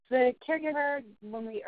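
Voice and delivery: ordinary talk, nothing marked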